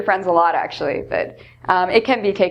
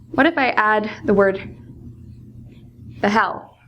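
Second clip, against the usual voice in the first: ordinary talk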